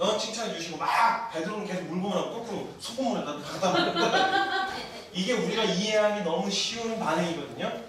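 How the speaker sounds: ordinary talk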